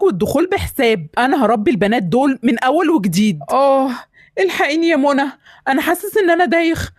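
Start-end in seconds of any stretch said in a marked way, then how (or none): 3.5-6.9 rising pained groans, calling for help; higher, strained cries of a man about to collapse; faint and dizzy. Acted. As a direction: voice climbing as he feels faint. Father collapses, feeling dizzy.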